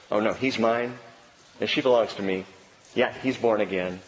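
low, calm voice of Jesus; calm defender. Acted. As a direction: answer the accuser gently, pitched low. Jesus calmly answers the accuser as advocate.

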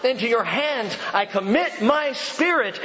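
high, impassioned cry read from scripture; Jesus crying out. A narrator's reading. Preacher voices Jesus' last words to his Father, high cry. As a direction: proclaim it high, starting on a peak. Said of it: "cried out with a loud voice".

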